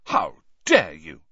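loud, theatrical indignation; mock outrage. A narrator's reading. Ron accused of making up predictions, mock outrage. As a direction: play the deeply offended party. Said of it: "said Ron in mock outrage".

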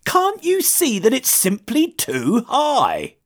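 high-pitched, dismissive scoff; scoffing toad. A narrator's reading. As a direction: pour scorn on the idea. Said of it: "scoffed the Toad".

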